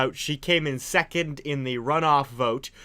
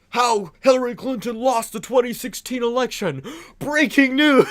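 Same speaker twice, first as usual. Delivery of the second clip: high, loud hammed-up headline read; mocking a CNN headline, read out high and loud; mock news anchor; 9 semitones higher, 6 dB louder than usual. Reading out a TV headline.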